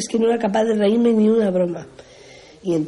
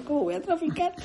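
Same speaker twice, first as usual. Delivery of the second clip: indignant disbelief; 9 semitones higher, 8 dB quieter, faster than usual. Retold or imitated. She questions the charge.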